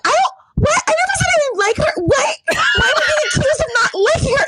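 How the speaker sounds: very high-pitched, rushed mock indignation; shocked and upset. Upset customer protests to staff, high hammed-up shock. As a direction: imitate a flustered customer protesting fast and high. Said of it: "shocked. She was so upset".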